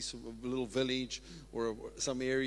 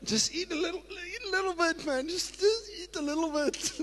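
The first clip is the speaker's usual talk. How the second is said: much higher, sing-song coaxing; coaxing offerer; 14 semitones higher than usual. Acted. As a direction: tempt them gently to take a bite. Plays someone offering fruit.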